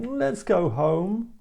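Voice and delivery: put-on slow, high, drawn-out bored child. Shown as a demonstration. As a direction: drag it out like a bored kid. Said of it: "from a bored child saying".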